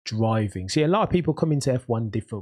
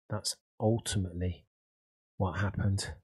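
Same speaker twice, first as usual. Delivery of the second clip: softer repeat; 5 dB quieter than usual. Shown as a demonstration. Summing up qualifying for viewers, soft reflective echo. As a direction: let it sink in, quietly. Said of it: "repeats the line softer".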